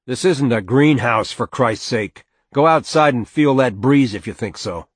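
loud, irritated father; angry retort. Acted. Father dismissing his wife's greenhouse talk, angry retort. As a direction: snap back loudly at the idea. Father angrily rejects her argument.

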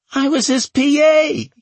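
very high, loud wail; piercingly high, panicked protest. A narrator's reading. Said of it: "Oakes wailed".

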